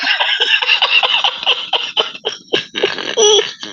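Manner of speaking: high airless laughter; real laugh at the punchline; breathy high laugh. Real, not acted. Laughing at own story.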